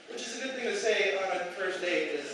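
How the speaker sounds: normal talking voice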